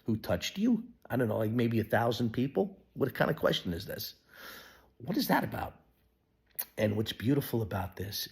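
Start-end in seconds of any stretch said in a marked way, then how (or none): 0.1-2.8 incredulous disciples. Acted. Disciples baffled by Jesus's question, incredulous. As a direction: toss it back as absurd. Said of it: "the disciples are like".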